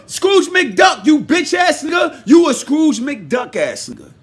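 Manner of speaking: loud, angry name-calling; angry insult shout. Real, not acted. Calling Joe Scrooge McDuck, loud angry shout.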